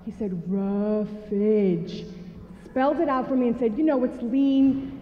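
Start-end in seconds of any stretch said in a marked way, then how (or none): 0.5-2.0 a hint of a condescending doctor, drawn out; talking down to a child. Doctor lecturing his patient, lightly mock condescending. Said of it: "like if I was a child". 3.8-5.0 slow, patient over-explaining. Retold or imitated. Doctor explaining food to patient, slow and condescending. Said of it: "like if I was a child".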